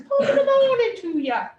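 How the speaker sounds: high, mock English gent accent. Teacher voices the monocyte with a mock English accent. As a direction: chirp it like a mild-mannered English gent in a top hat. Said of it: "I don't know why he's English".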